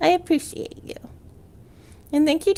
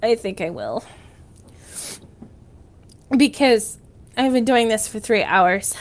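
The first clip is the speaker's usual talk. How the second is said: normal talking voice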